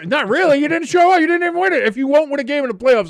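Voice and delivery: high, loud rapid rebuttal; heated argument. Real, not acted. Host rejects co-host's playoff claim, heated high rebuttal. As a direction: shout down the rival fan's excuse. Arguing over playoff record.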